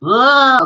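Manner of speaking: high, loud disgusted groan; whole school's groan. Acted. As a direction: groan out "ugh" like bored pupils. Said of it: "the school groaned".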